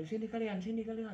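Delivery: high, carrying call across the yard. Retold or imitated. To the three friends waiting outside, an urgent high call. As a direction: summon them in from outside, urgent.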